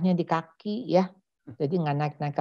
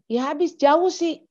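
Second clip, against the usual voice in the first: put-on high-pitched protesting kid; put-on high voice, mock complaint; child making excuses; 10 semitones higher than usual. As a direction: protest like a kid defending themselves. Child's excuse to parent, acted.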